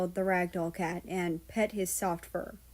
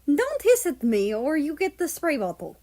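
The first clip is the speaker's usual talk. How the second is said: scolding; 6 semitones higher, 4 dB louder than usual. Acted. Scolding the cat.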